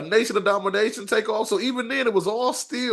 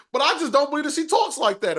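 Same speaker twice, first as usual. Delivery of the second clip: ordinary delivery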